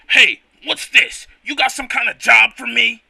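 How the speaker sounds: booming work boss; gruff boss voice. Acted. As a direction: grumble it out like a big boss. Voicing boss Girder.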